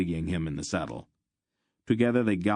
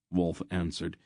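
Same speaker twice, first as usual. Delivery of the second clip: ordinary delivery, nothing marked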